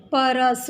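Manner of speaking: परस split as pa-ras, the र given its own vowel; wrong pronunciation. Shown as a demonstration. Teacher showing the class the wrong reading, split syllables. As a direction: say परस as three open syllables, pa-ra-sa. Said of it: "तो ऐसे नहीं बोलेंगे हम".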